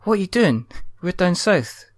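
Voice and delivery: mock customer. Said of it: "It's like".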